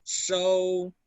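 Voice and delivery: "Show" as shou, long hushing start; SH sound. Shown as a demonstration. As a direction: model Mandarin "Show", held level. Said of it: "la S H".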